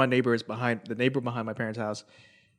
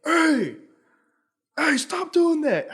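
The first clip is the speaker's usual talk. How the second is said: high, slow mock yell from afar; imitating a neighbor dad yelling out the window; retold yell; 5 dB louder, much slower than usual. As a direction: call out like a dad from an upstairs window. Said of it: "some voice out of nowhere, like, was like".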